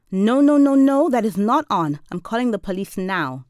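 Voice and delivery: loud, high outburst, easing toward the end; incensed. A narrator's reading. Woman incensed at boy holding a snowball, loud angry outburst. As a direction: burst out outraged, then come down to a threat. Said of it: "I'm incensed".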